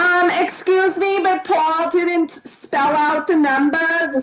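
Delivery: slow, drawn-out, exaggerated imitation of Rhonda's accent; horrible imitation of Rhonda's accent. Contestant protesting a rival's answer to the host, mock imitation of Rhonda's accent. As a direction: stretch the words out in a badly mimicked accent like Rhonda's. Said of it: "my horrible imitation of your accent".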